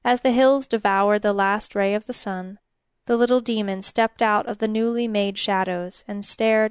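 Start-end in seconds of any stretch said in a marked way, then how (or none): none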